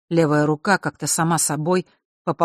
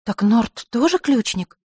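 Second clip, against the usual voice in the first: surprised; 6 semitones higher, slower than usual. Acted. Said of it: "удивлённо спросила Василиса".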